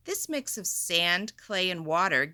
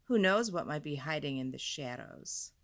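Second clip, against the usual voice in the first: ordinary delivery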